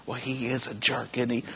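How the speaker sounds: low, very soft aside; gossipy mutter. Acted. Worker gossiping about the boss at work, gossipy mutter. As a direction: drop your voice to share gossip.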